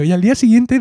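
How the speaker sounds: usual speaking voice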